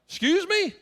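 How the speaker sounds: high, taken-aback question; startled, high-pitched double take. Retold or imitated. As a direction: ask it in mock disbelief. Retold startled reply to surprising request.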